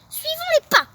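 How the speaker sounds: high loud go-ahead; rally your friend; eager call. Acted. Sekou urging Lilou to follow the tracks. Detectives set off.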